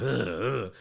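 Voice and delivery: loud mock grunts with attitude; imitated huffy grunts. Barber imitating a boy getting upset, huffy grunting. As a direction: grunt like a worked-up kid. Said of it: "getting an attitude".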